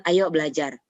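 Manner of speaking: low-key, even-paced urging; low intonation. Shown as a demonstration. Parent asking a child to study, low intonation. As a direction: invite the child without raising the pitch. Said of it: "itu intonasinya tuh beda".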